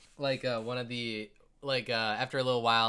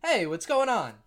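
higher, louder casual hail; approaching stranger; 8 semitones higher, 6 dB louder than usual. Acted. Man approaching the kids and player, raised friendly hail. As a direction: call over to the group. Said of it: "And he's just like".

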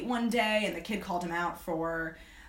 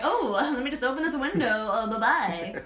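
fingindo fuga educada; 4 semitones higher, 4 dB louder than usual. Pretend. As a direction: make a high, playful pretend getaway. Imagined escape from creepy dad's car.

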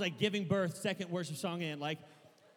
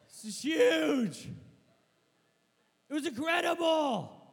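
excited shout; 8 semitones higher, 5 dB louder, slower than usual. Acted. Reliving the goal, shouting.

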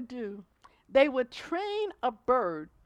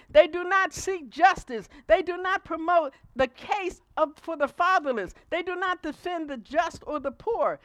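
preached scripture reading; 6 semitones higher, 8 dB louder than usual. Acted. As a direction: proclaim the verse to the congregation. Reads scripture with force.

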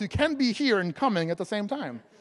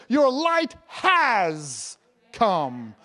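HAS held very long before "come"; slow right down and lean hard on "has"; stress on HAS; 4 dB louder, much slower than usual. Real, not acted. Preacher focusing on the has part, HAS drawn out. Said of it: "focus right there on the "has" part".

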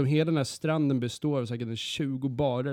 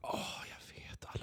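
hushed, unvoiced aside; whisper; 16 dB quieter than usual. Real, not acted. Whispering about the beach sex to friends. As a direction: drop to a whisper to share the gossip.

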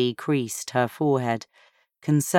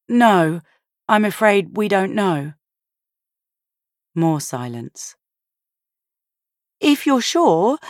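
higher, polite phone voice; a lightly voiced woman answering a call; Sophia on the phone; 5 semitones higher than usual. A narrator's reading.

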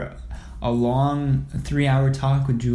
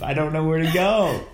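usual speaking voice

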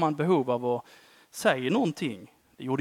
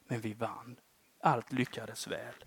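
hushed testimony; 5 semitones lower, 13 dB quieter than usual. Real, not acted. Quiet closing testimony.

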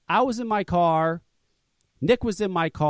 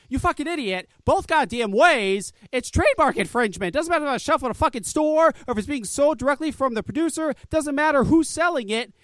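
raised, heated, swearing tirade; angry rant; 7 semitones higher than usual. Real, not acted. Ranting at the rival's stupid response, heated and angry. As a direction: let the contempt boil over into it.

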